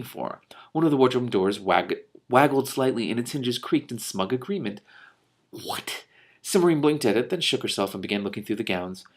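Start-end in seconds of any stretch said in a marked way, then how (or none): 5.5-6.1 breathy unvoiced what; whispered what. Real, not acted. Reader reacting to the smug wardrobe, whispered. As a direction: whisper it in disbelief.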